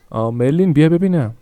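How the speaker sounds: a mother summoning her daughter; calling out. Acted. (صدا زدن بلند دختر)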